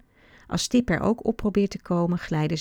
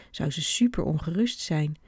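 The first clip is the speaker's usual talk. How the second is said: plain reading voice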